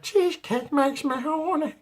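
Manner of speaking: squeaky, slow impression of a cartoon woman; high-pitched cartoon voice. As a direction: stretch it out way up high. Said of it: "we want you to do the voice".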